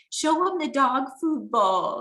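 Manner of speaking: imitating emotional dad. Daughter imitates her dad wanting friends shown the picture, emotional and slow. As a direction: mimic a dad pleading, words stretched out. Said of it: "he gets really emotional".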